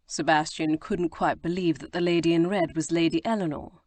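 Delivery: usual speaking voice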